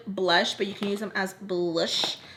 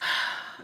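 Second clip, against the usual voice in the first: heavy exhale; annoyed sigh; 5 dB quieter than usual. Real, not acted. Makeup artist fed up with being played, heavy annoyed sigh.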